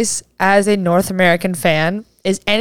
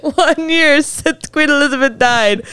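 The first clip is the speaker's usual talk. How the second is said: laughing; 5 semitones higher, slower than usual. Real, not acted. Laughing announcement.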